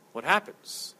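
ordinary delivery

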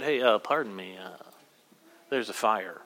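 low, soft, offhand imitation of someone interrupting; tone-deaf casual warning. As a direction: play it mock-casual, politely understated, no alarm. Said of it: "if their tone was off".

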